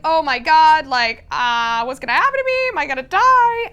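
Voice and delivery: high, loud panicked questions; terrified panic. Retold or imitated. Odette thrown into the dungeon corner, high loud panic. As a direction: spiral into fear of dying.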